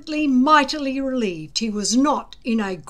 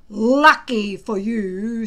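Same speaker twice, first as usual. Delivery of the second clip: ominous; 6 dB louder, slower than usual. A narrator's reading. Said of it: "said Gobber ominously".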